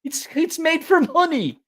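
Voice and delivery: very high, excited movie-character quote; Thirteenth Warrior character. Retold or imitated. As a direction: imitate a film hero's eager cry. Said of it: "he's like".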